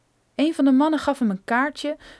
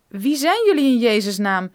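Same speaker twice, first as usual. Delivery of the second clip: loud, demanding cry; desperate cry; 7 dB louder than usual. A narrator's reading. Kidnapped geologist shouting at his captors, loud. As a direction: cry out for answers. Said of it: "riep Brophy".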